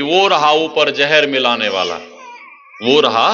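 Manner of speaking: excited spotting. Acted. Bandit spotting the hidden man up the tree, excited call. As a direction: call out as if you've just spotted him.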